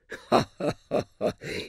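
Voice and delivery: put-on high, boyish giggle; light peal of high laughter; prince's laughter. A narrator's reading. Prince laughs at waiter's remark.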